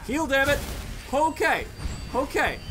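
pleading with the game in frustration; frustrated yell. Real, not acted. Low health, desperate to heal.

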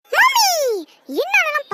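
high, loud yell for mummy; calling out. Acted. Child to mother before refusing the dishes, calling out.

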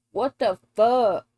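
ordinary talk, nothing marked

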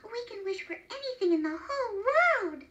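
high-pitched wonder; excited exclaiming. A narrator's reading. Wife exclaims to woodcutter about wishes, excited. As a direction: marvel excitedly, voice lifted. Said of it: "she exclaimed".

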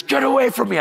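high-pitched mock yell; strained high retort, not full volume; retold screaming. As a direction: imitate an injured man pushing help away. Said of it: "he's screaming".

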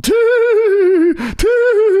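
put-on very high, loud childish wail; wailing little girl. Imitating the girl crying after the fall, high wail. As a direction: howl it like a six year old who just fell.

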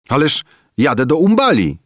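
put-on high, loud boyish protest. A narrator's reading. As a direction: object loudly and high. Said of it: "sprzeciwił się".

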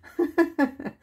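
a high burst of real laughter. Real, not acted. Laughing while recalling the zipline net. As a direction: laugh out loud at the memory. Laughs after her retold reply.

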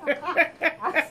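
real giggly telling; laughing through words. Real, not acted. Laughing while telling about daddy.

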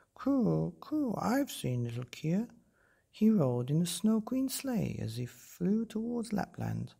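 high cooing dove. Acted. Dove speaks.

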